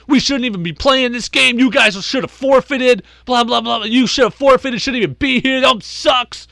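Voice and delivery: angry taunt. Retold or imitated. Ejected coach shouts at the team.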